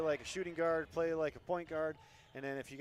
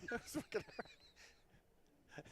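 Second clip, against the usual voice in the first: laughing through the words; breathy laugh while talking; 10 semitones higher, 9 dB quieter, much slower than usual. Real, not acted. Laughing after chasing ball.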